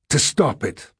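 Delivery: high, emphatic exclamation; determined cry. A narrator's reading. Diarist answering what he intends, determined cry. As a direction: cry it out with resolve. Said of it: "I cried".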